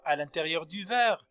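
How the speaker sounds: ordinary talk, nothing marked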